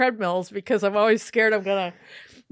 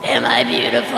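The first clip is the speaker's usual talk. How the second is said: much lower, sinister ghost voice; deep demonic ghost; 9 semitones lower than usual. Acted. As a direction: drop into a low monstrous growl of a question. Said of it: "Or maybe more like".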